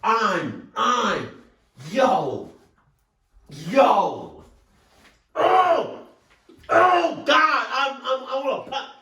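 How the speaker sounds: high, loud, stammering cries; overwhelmed high-pitched yelling; worked-up outburst. Real, not acted. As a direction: lose control and cry out in shock. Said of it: "let me calm the fuck down".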